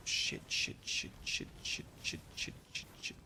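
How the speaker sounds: breathless whispered curses; whispered panic. Real, not acted. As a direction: hiss it under your breath. Whispered curses under pressure.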